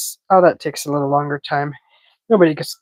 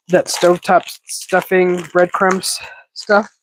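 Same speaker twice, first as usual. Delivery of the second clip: normal talking voice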